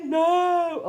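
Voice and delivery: loud, high mock no; the lady's flat refusal. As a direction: refuse like the stubborn friend. The lady refusing.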